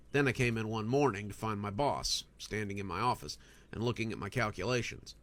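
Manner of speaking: ordinary delivery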